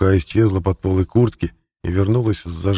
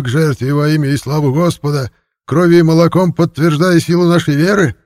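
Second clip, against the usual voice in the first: much higher, louder fervent sermon; fiery preaching; 9 semitones higher, 5 dB louder than usual. Acted. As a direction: thunder it to the flock.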